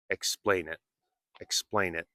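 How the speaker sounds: "Explain it" run together as one word, T unreleased; quick linked speech. Shown as a demonstration. Teacher modeling quick practice, "Explain it" softly run together. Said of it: "If you speak quickly, you can practice saying".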